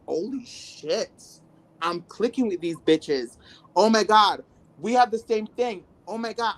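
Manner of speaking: rising joy at finding her people; excited realization. Retold or imitated. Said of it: "I'm like".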